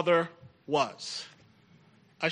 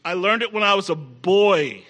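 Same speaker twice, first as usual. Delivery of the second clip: ordinary talk, nothing marked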